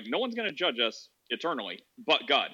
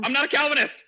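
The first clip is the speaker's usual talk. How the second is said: high, loud indignant denial; playful outraged correction; loud protest; 11 semitones higher, 7 dB louder than usual. Real, not acted. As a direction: shout it down, half laughing. Protests being called Calvinist.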